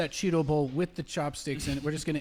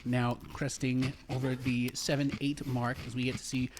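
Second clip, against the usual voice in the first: sports commentary; 2 semitones lower, 4 dB quieter than usual. Acted. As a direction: call the race like a hushed commentator. Play-by-play of eating contest.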